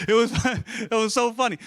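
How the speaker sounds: high, loud, amused through laughter; real laughter breaking into the words; laughing. Real, not acted. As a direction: crack up while saying it. Cracking up at his own story.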